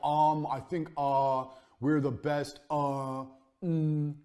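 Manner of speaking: mock unsure pitch full of "um" and "uh"; hesitant ums. Shown as a demonstration. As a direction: stall and hedge before "we're the best". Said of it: "Did you notice how smooth I was".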